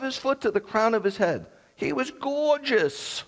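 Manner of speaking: hammed-up adoring sigh of a line; mock swooning. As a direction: swoon over him like a starstruck fan. Preacher gushes over Absalom's looks.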